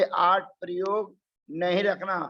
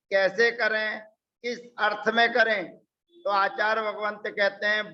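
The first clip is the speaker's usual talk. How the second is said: usual speaking voice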